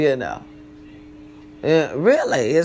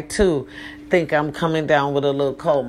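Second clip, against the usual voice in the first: ordinary delivery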